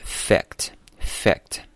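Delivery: "fact" with the c cut off before the final t; stopped consonant. Shown as a demonstration. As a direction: say "fact" slowly, holding back the c. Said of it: "声音不用出来". (fact 中的 c 受阻不爆破)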